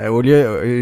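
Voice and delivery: ordinary talk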